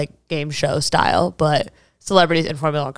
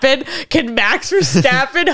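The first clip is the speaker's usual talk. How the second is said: ordinary talk, nothing marked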